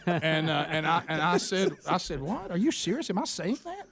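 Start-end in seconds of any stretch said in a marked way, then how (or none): 2.2-3.9 high, disbelieving what; incredulous. Retold or imitated. Telling his son he can't believe it, high and incredulous.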